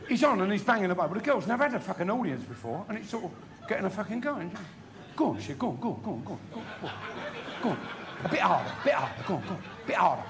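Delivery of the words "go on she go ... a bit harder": egging on. Acted. Girl egging on the man.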